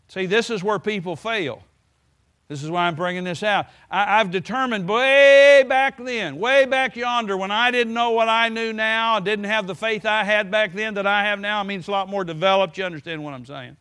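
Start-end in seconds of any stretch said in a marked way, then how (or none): 3.9-9.2 louder, slow, pressed emphasis; emphatic preaching. Acted. Preacher telling the congregation his resolve, loud and slow. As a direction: drive it home slowly and loud.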